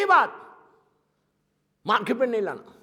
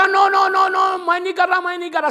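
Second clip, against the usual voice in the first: raised, insistent crowd protest; 6 semitones higher than usual. Acted. The crowd shouts at Moses that it is not them. Crowd denies sin loudly.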